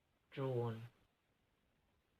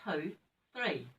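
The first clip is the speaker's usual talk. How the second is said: ordinary delivery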